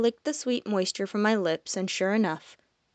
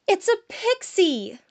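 much higher, louder, bubbling with delight; jovial; 12 semitones higher, 4 dB louder, slower than usual. Acted. Malia calling Evan over to the pixie, jovially excited. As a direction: gush like you found treasure. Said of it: "an impossibly jovial voice".

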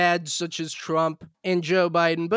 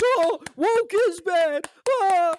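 mock seal crowd; 9 semitones higher, slower than usual. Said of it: "they just clap like seals".

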